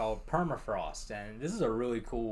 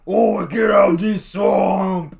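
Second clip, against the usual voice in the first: Shrek orc shout; 10 semitones higher, 19 dB louder than usual. Retold or imitated. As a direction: roar it like an angry ogre. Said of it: "themed Shrek four into forty K".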